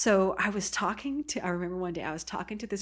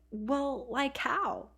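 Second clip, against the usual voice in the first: put-on higher-pitched Valley Girl lilt; West Coast Valley Girl; 6 semitones higher, much slower than usual. Oregon teen challenging the Texas girl, light Valley Girl accent. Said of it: "Valley Girl surfer thing going on".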